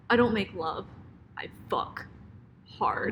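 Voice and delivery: hushed, dropping into a whisper; mock seductive line. Co-host shows friend how to say it, whispering. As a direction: imitate a sultry film line, whispered, with pauses. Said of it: "you gotta say it like".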